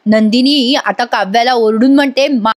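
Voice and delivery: ordinary talk, nothing marked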